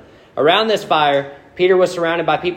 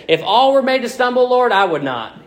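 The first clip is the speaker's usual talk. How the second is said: higher-pitched, emphatic vow; boastful Peter; 6 semitones higher than usual. Acted. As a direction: declare it with big-mouthed confidence. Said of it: "Peter had that big mouth again".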